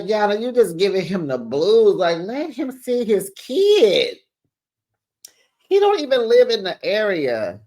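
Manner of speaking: pleading. Real, not acted. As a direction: beg her to let him. Pleading with Yana.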